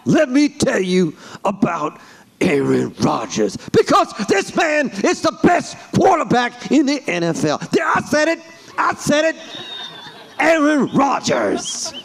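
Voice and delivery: emphatic, high-pitched sports pundit rant; Stephen A Smith. Shown as a demonstration. Pundit arguing for the best quarterback, vehement rant. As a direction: build from measured setup to outraged high exclamation. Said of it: "Stephen A Smith".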